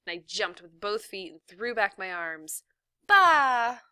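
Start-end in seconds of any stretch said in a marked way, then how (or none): 3.1-3.8 high, loud, drawn-out shout; mock cheer finish. A narrator's reading. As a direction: belt out the cheer's last syllable.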